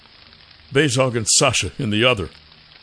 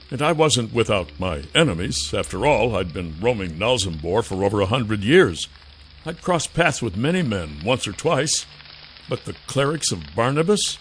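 plain reading voice

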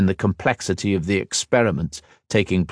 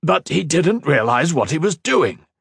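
shot back; 9 semitones higher than usual. A narrator's reading. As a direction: fire back fast. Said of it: "Tamar shot back".

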